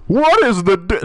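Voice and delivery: bad comedian impression, high and loud; Jerry Seinfeld. Streamer trying a comedian impression for laughs, high and loud. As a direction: start a hammed-up, high-pitched comedian bit. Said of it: "I gotta do a Jerry Seinfeld impression now".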